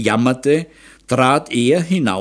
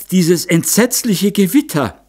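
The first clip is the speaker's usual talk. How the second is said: fearful moan; 8 semitones higher than usual. A narrator's reading. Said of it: "Die Glesberg stöhnte".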